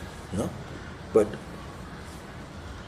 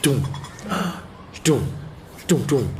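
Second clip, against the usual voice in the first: thud noises; 3 semitones higher than usual. Retold or imitated. Imitating the tool hitting box.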